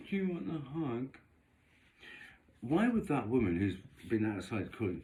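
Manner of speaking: ordinary talk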